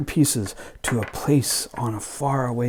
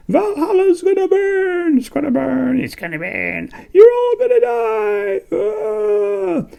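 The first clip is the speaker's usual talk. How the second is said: shrieking high witch cries; loud high witch shrieks; witchy prophecy; 9 dB louder than usual. Acted. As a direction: wail doom like a witch. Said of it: "three witchy women".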